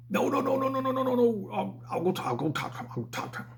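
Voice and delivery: high, frantic manager voice, fading to a hush; a flustered boss pleading not to call police; squeaky high pleading, dropping to a whisper; panicked manager. Retold or imitated. Panicked manager voice in retold scene.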